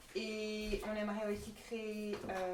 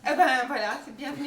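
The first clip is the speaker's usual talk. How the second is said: laughing; 3 semitones higher, 12 dB louder than usual. Real, not acted. Laughs at offer of rubble.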